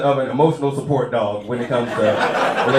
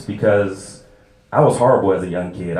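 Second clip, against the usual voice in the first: normal talking voice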